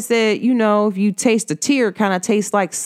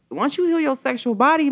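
ordinary delivery

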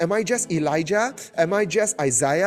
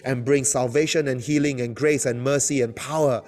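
fervent prayer; 4 semitones lower, faster than usual. Acted. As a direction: call down power over the church. Intercessory prayer at force.

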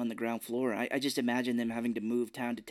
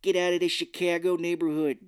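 loud, higher mock Chicago shout; Chicago accent; 5 semitones higher, 6 dB louder than usual. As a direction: order them out in a hammed-up Chicago accent. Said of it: "it would be more like".

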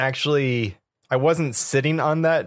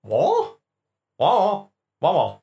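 droid babble; 6 semitones higher than usual. Acted. Droid noises.

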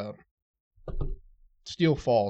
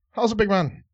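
put-on higher, louder pikey accent, "How's it, big man?" rushed into one blur; fast pikey accent; 8 semitones higher, 8 dB louder than usual. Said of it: "saying it so damn fast".

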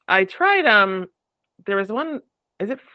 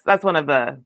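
ordinary delivery

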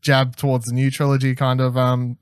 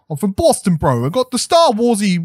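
put-on a loose, higher Boston bro accent; mock Boston bro, pushed up and out; half-joking Boston accent impression; 8 semitones higher, 4 dB louder than usual. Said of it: "that's my attempt at a Boston accent".

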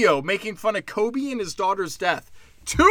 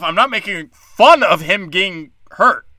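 ordinary delivery

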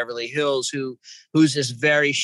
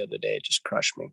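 quiet grief; 4 semitones lower, 5 dB quieter than usual. Real, not acted. Grief over a lost bird.

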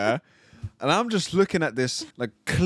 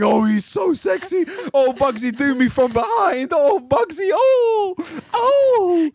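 much higher, louder, building into mock ecstatic cries; mock swooning women; 12 semitones higher, 5 dB louder, slower than usual. He acts out women's comments about the rapper, rising to hammed-up moans. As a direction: ham up a crowd of swooning fans. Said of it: "every comment was a woman saying".

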